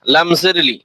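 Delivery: normal talking voice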